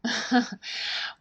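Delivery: short, quiet chuckle; soft laugh. Real, not acted. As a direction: laugh softly before replying. Amused at the other speaker's modesty.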